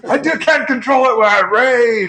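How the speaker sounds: high, very loud mock-rage shout; hammed-up raging yell. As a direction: shout back as if rage has taken over. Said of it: "Stop yelling!".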